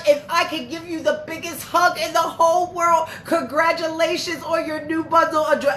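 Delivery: shower her with happy congratulations; gushing congratulations. Real, not acted. Streamer congratulating Lasiah on her new baby, joyful high gushing.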